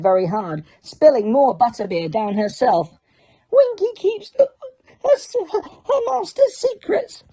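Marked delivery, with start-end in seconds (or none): 3.5-7.2 mutinous elf. A narrator's reading. Winky refuses Harry, high and sulky. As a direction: sulk it defiantly. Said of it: "she said mutinously".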